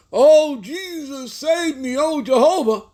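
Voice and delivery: high, slow mock wail to Jesus; hammed-up panicked cry for salvation; mock desperate pleading. Preacher mocking sinners crying out for rescue, high mock wail. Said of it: "people are out there yelling".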